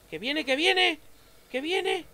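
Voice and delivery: high, loud alarmed cry; frightened yell; panicked shout. Real, not acted. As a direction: warn in sheer panic. Dog charging at him.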